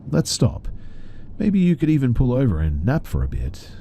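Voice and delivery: high, lilting creepy coaxing; sing-song voice. A narrator's reading. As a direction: coax him to pull over in a singing lilt. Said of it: "sing-song voice from earlier".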